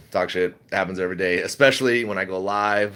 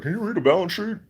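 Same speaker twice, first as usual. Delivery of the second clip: high, put-on voice asking a snide question; mocking commenter; 10 semitones higher than usual. Livestream host mocks a commenter's question in a high sneer. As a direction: mimic a smug troll in a high sneer. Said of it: "people come in like".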